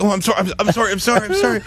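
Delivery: high, frantic repeated apologies; panic-apologize, voice way up; flustered apology. Retold or imitated. Mimicking himself apologizing to the crying boy. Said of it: "and I'm like".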